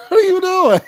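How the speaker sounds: high, loud through laughter; bursting out laughing mid-line. Real, not acted. Host laughing at a guest's fake tears.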